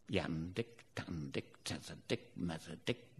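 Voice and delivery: low, murmured counting chant; hushed sheep counting. Shown as a demonstration. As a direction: count under your breath. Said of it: "That was Lancashire sheep counting".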